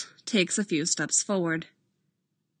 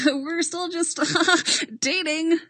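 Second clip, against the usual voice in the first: high, laughing through the words; nervous laugh; 10 semitones higher, 3 dB louder than usual. Acted. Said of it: "with a slightly maniacal laugh".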